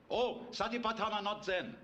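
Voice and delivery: a lightly voiced monk correcting him; imitating the monk.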